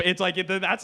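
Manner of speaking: usual speaking voice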